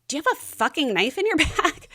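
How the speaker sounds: exasperated wife confronting husband at security; incredulous confrontation. Retold or imitated. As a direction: hiss it at him in disbelief, a touch of a laugh.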